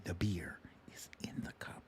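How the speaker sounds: hushed secret whisper, nearly silent. Acted. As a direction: let them in on the secret in a whisper. Repeats the line as a secret.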